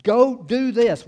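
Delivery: preacher voicing the verse as a direct order; imperative command. Shown as a demonstration. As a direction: bark it out as an order. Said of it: "it's imperative".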